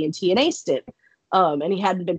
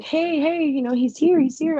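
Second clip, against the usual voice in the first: high, urgent alert; high-pitched, insistent heads-up; excited mom; 8 semitones higher than usual. Retold or imitated. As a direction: imitate an excited mom calling attention. Mom alerting her as he arrives.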